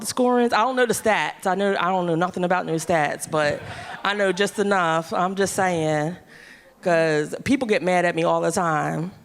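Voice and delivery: ordinary talk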